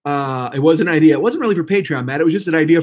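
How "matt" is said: usual speaking voice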